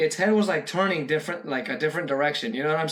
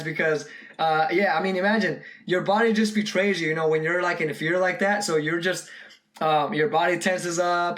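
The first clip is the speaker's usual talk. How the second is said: usual speaking voice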